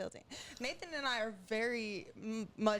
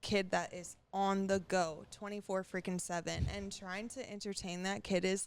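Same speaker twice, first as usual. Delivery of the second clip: ordinary talk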